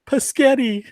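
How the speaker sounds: high-pitched yell. Retold or imitated. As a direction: shout it back while zooming away. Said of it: "he yells out".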